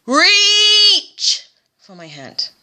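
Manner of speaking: high, loud, slow crying plea; intense cry. Pretend. Speaker to struggling doctors as Samwise, crying plea. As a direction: wail it out, near tears. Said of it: "A little bit intense at the end there".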